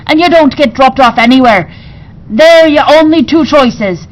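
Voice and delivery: pirate queen threatening the captive narrator; pirate queen threat. A narrator's reading.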